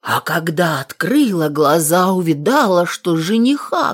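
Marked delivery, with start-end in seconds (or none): none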